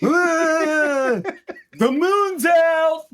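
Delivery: mock Doc voice. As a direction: crow it down from the sky like a showoff.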